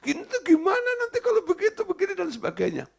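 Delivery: high, quick, anxious fretting; fretful mock worrier. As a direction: rattle it off like a panicking parent. (meniru orang yang panik dan cemas)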